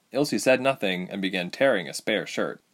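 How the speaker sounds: usual speaking voice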